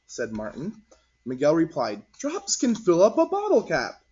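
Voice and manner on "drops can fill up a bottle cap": put-on high, eager child's voice. Miguel telling friends about drops, high child voice. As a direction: pitch it up like a small boy.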